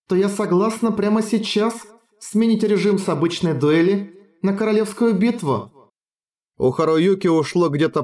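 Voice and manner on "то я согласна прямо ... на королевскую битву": put-on high, childish little voice; sweet innocent child voice; cutesy baby talk. A narrator's reading. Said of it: "произнесла совершенно детским голоском".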